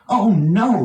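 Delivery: a sudden dismayed yelp; exclaimed. A narrator's reading. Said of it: "he exclaimed".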